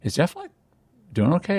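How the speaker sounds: high, softened doubtful question; tentative retold skepticism; skeptical. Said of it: "skeptical. I wasn't being cruel or mean".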